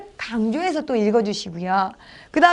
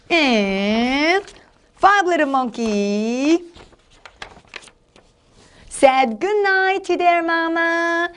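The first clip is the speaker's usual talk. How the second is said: put-on slow, drawn-out storybook reading for children; lively storytelling; 2 semitones higher, much slower than usual. Shown as a demonstration. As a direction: read it vividly and playfully, stretching the words. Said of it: "이렇게 실감나게 재밌게 해야지".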